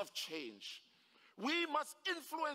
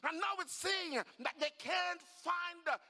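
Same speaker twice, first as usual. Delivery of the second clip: high, insistent explaining; worked-up, emphatic justification; excited father; 6 semitones higher than usual. Acted. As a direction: plead the case with mounting wonder. Father excitedly defends his shouting.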